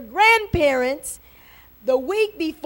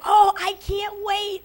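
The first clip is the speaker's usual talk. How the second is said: higher, eager exclamation; excited anticipation; 4 semitones higher than usual. Acted. Someone before a special day, acting out excitement. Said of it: "you're just so excited".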